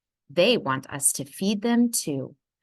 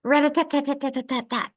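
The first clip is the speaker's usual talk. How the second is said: fast, higher rat-a-tat; woodpecker tapping; 7 semitones higher, faster than usual. A narrator's reading. Reading to a child, tapping out the woodpecker. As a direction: rattle it off like a little hammer. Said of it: "sounds like a little hammer".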